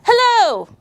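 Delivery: loud, high booming hello; a shy boy suddenly yelling a greeting; yelled hello. Acted. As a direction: blurt it out loud like a nervous new kid. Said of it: "then yelled out".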